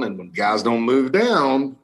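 ordinary delivery

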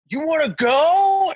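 high, slow, loud shout; challenging shout. Retold or imitated. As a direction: yell a challenge to fight. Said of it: "So he is like".

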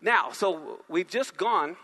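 usual speaking voice

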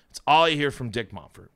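usual speaking voice

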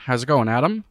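ordinary delivery

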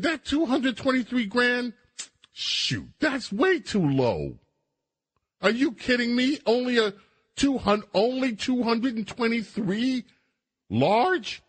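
high-pitched mock outrage at too little money; a host imitating the assemblywoman, high and indignant; mock outraged politician. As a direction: squeal like she's insulted by the sum. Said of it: "You know what she said? She said".

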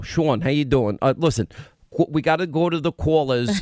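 usual speaking voice

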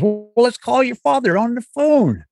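a playful Bill Cosby send-up, teasing a nervous fan; Bill Cosby impression. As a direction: lazily drawl it out like a famous sitcom dad. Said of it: "And Bill Cosby goes".

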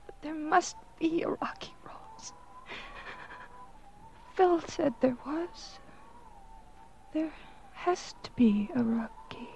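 breathless, fading whisper; dying whisper. Acted. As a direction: her last words slipping away.

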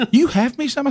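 high, hammed-up disbelief as the wife; mock surprised wife. Husband imitating his wife hearing there's a gift, high mock surprise. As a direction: squeak it out like a stunned wife. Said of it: "She goes".

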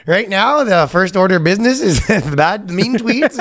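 officious put-on Canadian accent. Officer explains he's here about mean tweets, Canadian accent. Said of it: "but it was so Canadian".

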